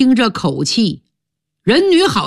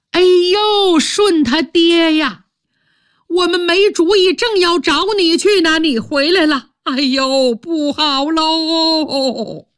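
a scheming wife putting on alarm; wailing stepmother; 8 semitones higher than usual. Acted. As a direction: stepmother crying out to her husband, high and wailing. Stepmother wails the bad news.

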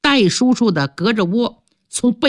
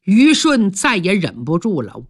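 ordinary delivery, nothing marked

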